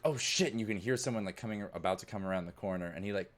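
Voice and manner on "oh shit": high sudden alarm; startled panic. Acted. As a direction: yelp of panic. Kid panics at footsteps.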